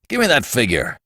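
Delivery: restrained but firm order; curt demand. A narrator's reading. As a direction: demand it without raising your voice much. Brewster first demands the figure.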